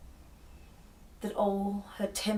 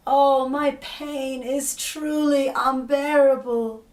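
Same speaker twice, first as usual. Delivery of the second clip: loud, higher, slow drawn-out lament; wailing in pain; 8 semitones higher, 12 dB louder, slower than usual. Acted. Ereshkigal in labor to the flies, slow loud lament. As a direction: stretch out the pain in a loud moan.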